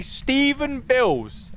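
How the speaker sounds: slow, high, drawn-out name; mock posh voice. Shown as a demonstration. Streamer showing the voice chat, drawn-out high. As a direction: say the name slowly like a stuffy butler. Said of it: "And then I say".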